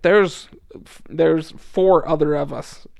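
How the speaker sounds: loud, pointed questions. Retold or imitated. Pressing angry man to wait.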